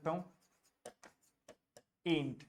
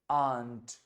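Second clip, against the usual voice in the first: ordinary talk, nothing marked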